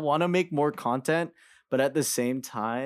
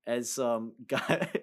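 usual speaking voice

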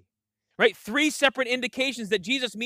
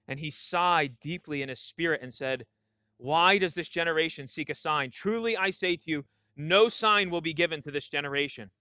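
measured, lower reading voice for scripture; 5 semitones lower, 3 dB quieter than usual. Acted. Pastor reads Mark to the congregation in a measured, lower reading voice. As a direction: read the passage aloud to the church.

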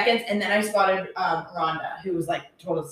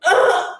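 a louder, higher choked sob on ugh; imitated sob; 11 semitones higher, 5 dB louder than usual. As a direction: copy the mother breaking down. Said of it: "and she just went".

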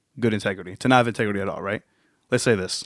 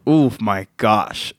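ordinary delivery

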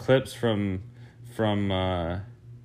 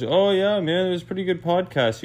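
high, excited mock voice of Shane; hammed-up high buddy voice; friend's quote; 9 semitones higher than usual. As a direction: squeak it out like an overeager buddy. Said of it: "He goes".

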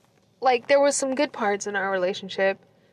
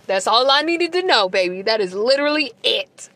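raised, emphatic sassy verdict; smug triumph; 5 dB louder than usual. Retold or imitated. Retold reply to the ex about the rival, triumphant.

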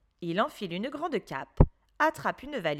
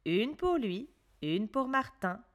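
plain reading voice

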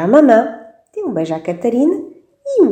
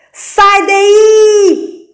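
much higher, louder yell; shout; 14 semitones higher, 8 dB louder than usual. Acted. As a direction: yell it with all your might. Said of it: "gritou a Catarina com todas as suas forças".

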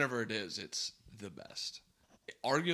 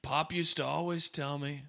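louder, higher politician impression; Trump; 5 semitones higher, 7 dB louder than usual. Asked to do a Biden voice, slips into Trump impression. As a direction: attempt a Biden that slips into a booming impersonation. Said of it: "You just do Trump again".

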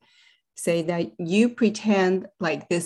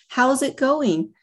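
bright, sing-song greeting, pitched high and falling; breezy acquaintance asking, all smiles; cheery small talk; 7 semitones higher, slower than usual. Retold or imitated. Read to mothers, a well-meaning acquaintance's cheery greeting. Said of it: "With optimistic smiles on their well-meaning faces".

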